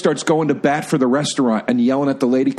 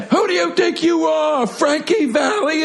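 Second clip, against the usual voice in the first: high, indignant mock Jersey yell; Karen from Goodfellas; 12 semitones higher than usual. Impression of Jersey girls with attitude, high indignant yell. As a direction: shout it like an outraged Jersey girl. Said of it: "they're all like Karen and Goodfellas".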